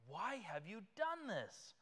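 high, slow, frightened demand; exceedingly afraid. Acted. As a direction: let the fear rise into the question. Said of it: "the men were exceedingly afraid".

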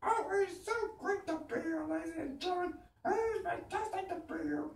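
very high, grating comic screech; shrieking comedian voice, way up high; Gilbert Gottfried. Acted. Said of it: "Gilbert Godfrey".

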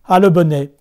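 loud scolding wife; wife shouting insults at her husband; angry shout. Acted. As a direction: yell at the foolish husband. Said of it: "s'écria la femme".

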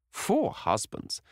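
very high and slow, drawn out in shock; astonished. A narrator's reading. Hostess reacting in shock to the gossip, high and drawn out. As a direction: stretch it out, scandalised.

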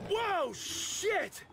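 sudden high startled cry. Acted. Player character startled by an animal, high alarm. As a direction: jump back in alarm.

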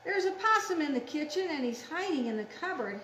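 quick, urgent picture-book alarm; excited story reading. Acted. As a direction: announce the intruder in a hurry.

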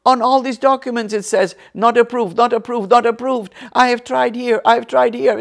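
loud, high, forceful protest; emphatic acted outburst at full voice. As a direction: imitate a man hammering out his complaint. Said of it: "he raised his voice".

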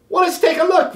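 cheerful let's-see tone; teacher voice. A narrator's reading. Teacher reassuring worried children, lightly voiced.

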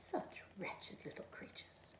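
slow whispered mutter. A narrator's reading. Snowman grumbles to himself after losing his nose, whispered. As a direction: mutter it under your breath, sour. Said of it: "he muttered".

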